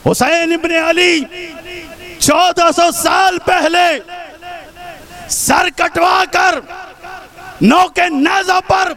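declamatory shout in separate phrases; impassioned preaching. Real, not acted. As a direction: proclaim it phrase by phrase to the rally.

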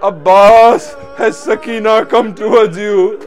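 loud, drawn-out call to a brother. Retold or imitated. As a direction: cry the name out across a distance. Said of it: "called out to her brother".

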